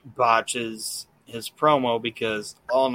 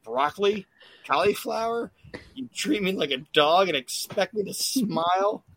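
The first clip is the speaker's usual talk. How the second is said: put-on high, whiny little boy voice, rising at each end; bratty kid; 7 semitones higher than usual. Bald boy at the table whining to his parents, higher kid voice. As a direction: play the spoiled kid complaining at dinner. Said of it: "He's like".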